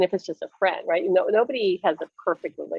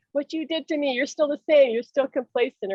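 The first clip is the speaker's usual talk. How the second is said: high mock reproach; retold angry accusation; 6 semitones higher than usual. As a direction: act out resentful blaming, not shouted. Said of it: "I got angry again".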